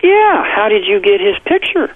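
put-on excited child. Father voicing son insisting about the picture, high eager child voice. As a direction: imitate the son chirping back. Son's voice imitated again.

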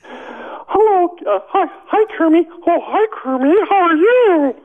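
high flirtatious Miss Piggy impression. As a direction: coo at Kermie like Piggy. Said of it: "Miss Piggy".

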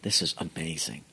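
very low, hushed murmur; hushed amazement. Retold or imitated. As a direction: say it under your breath, stunned. Retells his stunned private thought.

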